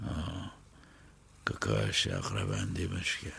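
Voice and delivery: low, slow, deep gangster voice; a hard man stating a fact; low, unhurried menace; deep tough voice. Acted. Boksor Ali, deep slow voice.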